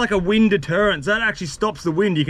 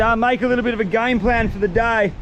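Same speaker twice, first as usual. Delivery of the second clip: normal talking voice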